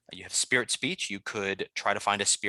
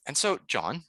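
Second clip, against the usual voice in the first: usual speaking voice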